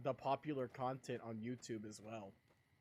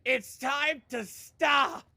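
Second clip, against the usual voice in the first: high, loud and slow, in a put-on voice; meme shout; 10 semitones higher, 11 dB louder, much slower than usual. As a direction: bellow it like the famous meme. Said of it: "I always go back and forth".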